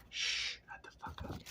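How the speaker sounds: hushed, unvoiced shushing; whisper. Real, not acted. Telling someone to shut up, whispered hush.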